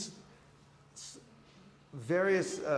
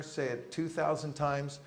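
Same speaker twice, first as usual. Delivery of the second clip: usual speaking voice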